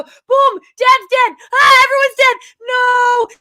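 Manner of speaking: loud hammed-up wail; mock dramatic shout. Recapping the movie's deaths, hammed-up loud wail. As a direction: belt out the tragedy for laughs.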